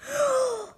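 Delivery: high, sharp intake of surprise; shocked gasp. Real, not acted. Child hears George speak like a person, high shocked gasp. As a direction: gasp at the twist.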